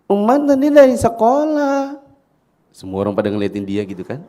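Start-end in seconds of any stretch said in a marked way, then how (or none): 0.1-2.0 put-on very high, loud small child voice; Umar. Small boy answering loudly in hushed mosque, high shout. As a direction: shout it out slowly like a little kid. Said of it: "Siapa itu anak kecil ngomong".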